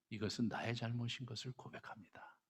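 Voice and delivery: hushed confession to God; whispering a confession; whispered prayer. Shown as a demonstration. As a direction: breathe the words like a private prayer.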